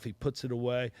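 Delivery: ordinary talk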